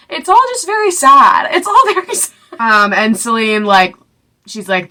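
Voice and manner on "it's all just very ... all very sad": very high, squeaky mock-sad voice; hammed-up squeal of sorrow. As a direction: play the sadness as a high-pitched joke. Comically summing up the sad plot.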